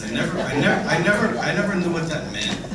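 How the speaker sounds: laughing while talking; chuckling through the words. Real, not acted. Laughing about unknown nickname.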